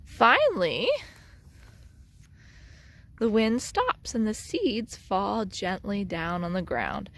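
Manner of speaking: ordinary delivery, nothing marked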